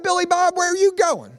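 high-pitched Southern call across the room; imitated Southern pastor. Pastor to friend leaving church, high calling out. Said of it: "He said".

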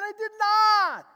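high, loud and slow; loud denial. Retold or imitated. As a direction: hammer the denial home. He denies it to the students.

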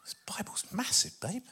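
whispered aside to his wife; a quick hushed aside. Real, not acted.

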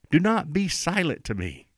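high-pitched plea, falling at the end; imitated crying out. Preacher voices David begging God, imitated crying out. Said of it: "David's crying out".

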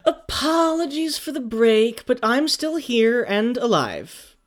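high, drawn-out dramatic announcement; slow, high-pitched grand proclamation; theatrical apology. Pretend. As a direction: stretch it out high with showy flourish. Mock-grand apology after a break.

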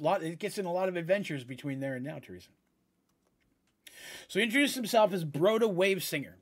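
ordinary talk

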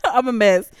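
ordinary talk, nothing marked

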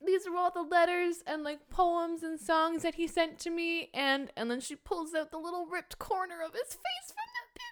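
high, soft tearful retelling; choked up. Real, not acted. Fan retells the saved letters and photo, choked up. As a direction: voice climbing and thinning toward tears.

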